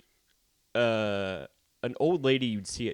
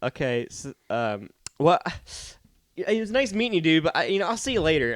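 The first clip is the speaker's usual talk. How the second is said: hesitant, awkward retreat, rising higher; Aaron; 5 semitones higher than usual. Retold or imitated. Nice kid backs away after seeing the scab, halting and nervous. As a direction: imitate a nervous excuse to leave. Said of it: "You seem, like, really nervous".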